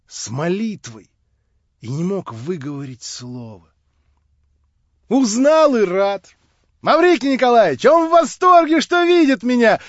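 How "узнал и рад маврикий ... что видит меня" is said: выше и громче, ликуя; звонкий восторг; восторженно. Acted. Лиза в восторге. (ликующая девушка)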